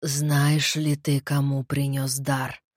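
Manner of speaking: low, very slow dead voice; undead voice. Acted. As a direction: question him slowly from the grave. Dead head questions the necromancer.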